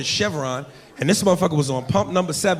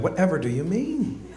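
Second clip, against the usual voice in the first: prim swooping feigned innocence; 5 dB quieter than usual. Retold or imitated. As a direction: stretch MEAN up in mock puzzlement.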